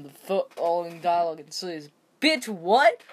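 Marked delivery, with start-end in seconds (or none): 2.2-3.0 outraged disbelief. Real, not acted. Reacting to slap.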